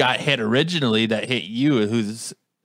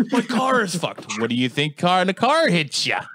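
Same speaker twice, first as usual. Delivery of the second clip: mocking cop; 4 semitones higher than usual.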